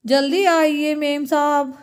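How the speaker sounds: slow, drawn-out vendor voice; milkman calling. Acted. Milkman calling madam to come, drawn-out. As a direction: call it out with stretched words.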